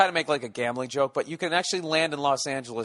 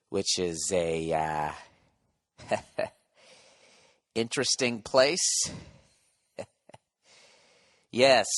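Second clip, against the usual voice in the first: mock creepy cabin announcement with long pauses; creepy announcer; 4 semitones lower, slower than usual. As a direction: draw it out low and slow with odd little laughs. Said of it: "it sounded creepy as fucking hell".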